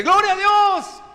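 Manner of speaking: high, loud, drawn-out "¡Gloria a Dios!"; loud proclamation. Retold or imitated. Man in the story loudly proclaims praise to God, high shout. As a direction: shout the praise up to the rafters, slowly.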